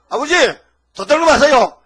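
loud, high cry to father; a son calling out loudly that a thief came; alarmed call. Retold or imitated. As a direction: shout the news of the burglary. Calling out to father about burglar.

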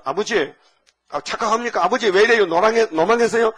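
high, loud alarmed cry of disbelief; a son startled, pleading with his father; shocked protest. Retold or imitated. Joseph protesting to his old father, high and alarmed.

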